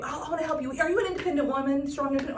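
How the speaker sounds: high imitation of a persistent young helper; pestering kid. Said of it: "And then he wouldn't go away".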